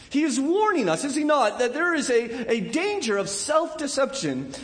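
high, loud fervent preaching; preaching at full force. Acted. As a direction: drive the warning home from the pulpit. Preaching climax, voice raised.

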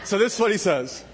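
chuckling through the words; laughing at his own joke. Real, not acted.

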